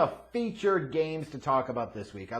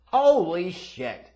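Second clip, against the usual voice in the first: ordinary delivery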